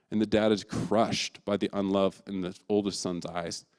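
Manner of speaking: normal talking voice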